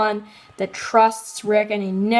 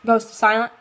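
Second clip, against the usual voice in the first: ordinary delivery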